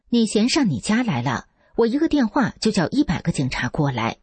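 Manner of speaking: a hint of hoodlum bluster; thuggish threat. A narrator's reading. Officer turning on the husband, mildly thuggish. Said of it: "即刻变成流氓嘴脸".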